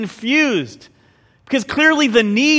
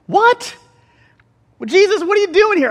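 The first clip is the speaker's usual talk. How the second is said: high, incredulous what; mock outrage; 10 semitones higher, 3 dB louder than usual. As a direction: play the baffled crowd. Voicing the disappointed crowd.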